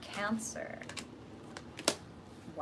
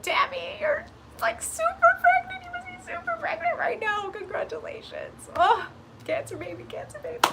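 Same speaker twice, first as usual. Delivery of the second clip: high, loud squealing congratulations; squealing congratulations at a pregnant viewer; excited squealing; 4 dB louder than usual. Real, not acted. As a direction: gush over the viewer's pregnancy.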